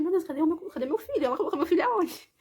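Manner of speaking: frantic mother asking where her son went; retelling her alarm to the viewers that her son was not there; retold panic. Panicking about missing baby.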